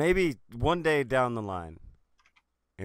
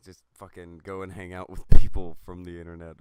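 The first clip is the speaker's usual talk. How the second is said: whispered; 7 semitones lower, 8 dB louder than usual. Real, not acted. Sighing aside.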